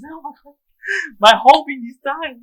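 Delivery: high, distraught cry. Retold or imitated. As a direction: wail over the dying friend. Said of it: "I told Susan".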